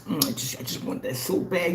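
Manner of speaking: soft, longing moan of hunger; mock craving. Imitating a hungry rival craving his paint, soft longing moan. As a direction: drool over food you can't have. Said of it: "seeing some barbecue chicken and just".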